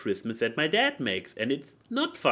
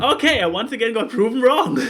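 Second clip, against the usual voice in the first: laughing exclaim; 10 semitones higher, 8 dB louder than usual. Real, not acted. Guess proven wrong again.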